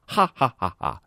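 哈 said as open Chinese ha, repeated; Chinese ha demo. Shown as a demonstration. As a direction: show the Chinese 哈 sound. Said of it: "汉语里那个哈". (示范汉语的“哈”音)

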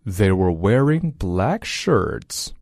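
slow, careful teacher reading; each word said deliberately; slow model reading. Shown as a demonstration. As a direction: read it slowly for students to copy. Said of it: "Repeat after me".

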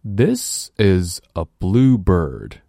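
very slow, every word spelled out; slow model sentence; slow teaching read. Shown as a demonstration. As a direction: drill it slowly for learners.

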